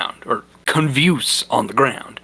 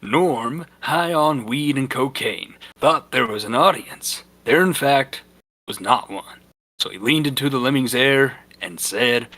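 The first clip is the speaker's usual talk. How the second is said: ordinary delivery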